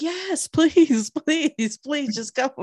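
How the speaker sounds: high, drawn-out playful begging; pleading. Retold or imitated. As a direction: beg with a laugh in it. Retold begging sister to be bold.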